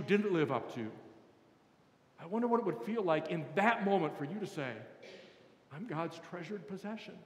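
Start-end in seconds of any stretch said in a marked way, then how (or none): 5.7-7.2 soft, understated murmur to oneself; quiet self-reassurance. Pretend. Believer to themselves in a bad moment, quiet reassurance. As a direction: say it gently, well below speaking level.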